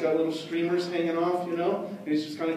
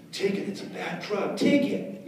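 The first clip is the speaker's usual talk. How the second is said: rising, pushy mock dealer; 3 semitones higher than usual. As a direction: press him harder each time. Pushing fake pill insistently.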